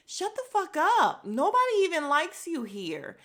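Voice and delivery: loud, high-pitched telling-off; exasperated scolding. Acted. Viewer telling off Wendy, loud exasperated scolding. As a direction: snap at her, voice jumping up.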